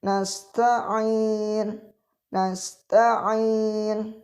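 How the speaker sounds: nasta'in with a clear, held ain in the throat; correct ain. Shown as a demonstration. Teacher shows students the right ain, slow and held. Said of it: "Yang tepat".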